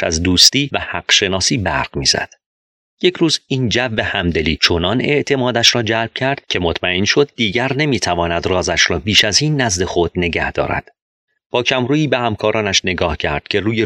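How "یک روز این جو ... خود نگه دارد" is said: ordinary delivery, nothing marked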